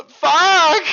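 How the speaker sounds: high gasp then loud curse; jolted by a hiccup; startled curse. Real, not acted. Streamer startled by a sudden hiccup, shouting a curse.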